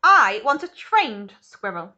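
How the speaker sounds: loud, slow stamping demand; loud slow spoilt demand; shouted. Acted. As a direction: drag out each word like a brat. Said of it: "Veruca shouted".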